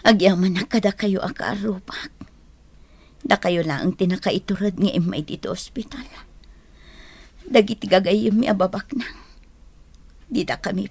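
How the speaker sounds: soft unvoiced hospital whisper; weak whisper. Injured wife in hospital bed thanking neighbors, faint whisper.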